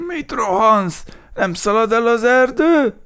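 high, loud indignant complaint; grumbling. A narrator's reading. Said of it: "elég gyakran mirgett, morgott".

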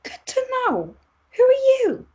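very high, indignant bookkeeper; indignant squeak. Acted. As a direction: shoot way up in pitch, affronted. Bookkeeper indignant at hair remark.

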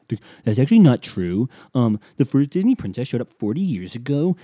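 loud, pedantic mock correction; put-on know-it-all protest; mocking defensive fan. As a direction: imitate a fan indignantly setting the record straight. Imitates defensive Disney fans correcting him.

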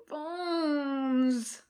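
long, high, whiny plea; crying out in protest. A narrator's reading. Jim appealing to his friend, crying out plaintively. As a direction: draw the name out in protest. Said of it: "Jim cries".

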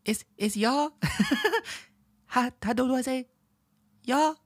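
put-on high-pitched giggly little-kid voice. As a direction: imitate a small child trying out English. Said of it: "until they went".

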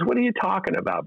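incredulous dad. Retold or imitated. Dad suspicious of the director.

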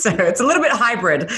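light laugh on the words, pitch up high. Real, not acted. Mother laughing to host about her kids' accents. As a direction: chuckle as you say it.

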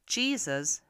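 ordinary delivery, nothing marked

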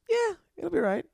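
very high, noncommittal imitation; reply in a squeaky-high, skeptical put-on voice; mock doubtful. Manager's doubtful reply, acted quote.

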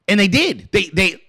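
usual speaking voice

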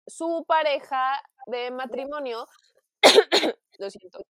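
ordinary talk

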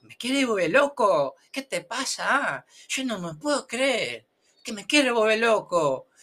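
high-pitched protest, vowels dragged out; exasperated learner. Acted. Student protesting the confusing pronunciation rules, exasperated. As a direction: wail it as a baffled, overwhelmed student. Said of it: "y tú dices".

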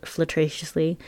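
ordinary delivery, nothing marked

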